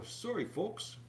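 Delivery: ordinary talk, nothing marked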